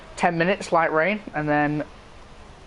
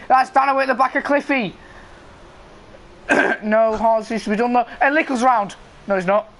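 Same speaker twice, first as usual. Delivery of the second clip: higher-pitched, excited start call; live race commentary; 6 semitones higher, 3 dB louder than usual. Real, not acted. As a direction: count the lights, then launch the race. Calls the first corner live.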